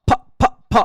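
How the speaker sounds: ফ, ফ, ফ said as a breathy puff of air; aspirated p. Shown as a demonstration. Teacher showing English aspirated p as a puff. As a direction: blow out ফ as an aspirated p. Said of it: "ওটার উচ্চারণ হচ্ছে কি এভাবে".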